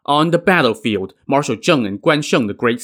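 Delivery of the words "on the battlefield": usual speaking voice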